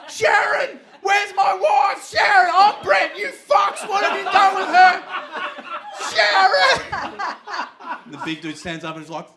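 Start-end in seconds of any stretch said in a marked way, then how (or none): 0.1-7.2 top-of-the-lungs scream, very high and loud; hammed-up desperate bellowing for a wife; full-throated yelling. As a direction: yell it out as loud as you can. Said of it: "at the top of my lungs, I start yelling".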